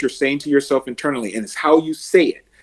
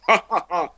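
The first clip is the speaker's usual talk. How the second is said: heroic laugh; 5 semitones higher than usual. Shown as a demonstration. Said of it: "No, no, no".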